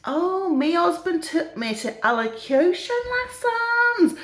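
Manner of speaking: put-on high, slow northern old lady; northern English edging towards refined; put-on northern English, carefully drawn out; northern accent turning posh. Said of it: "So her accent's changing a bit".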